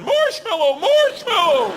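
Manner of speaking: desperate high yell of "marshmallow"; panicked scream. Retold or imitated. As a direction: scream the safeword in terror. Mauled by bear, screaming safeword.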